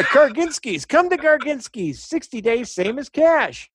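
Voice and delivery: high hard sell ad voice; cheesy car lot radio spot; used car salesman. Pretend. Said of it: "That sounds like a used car salesman".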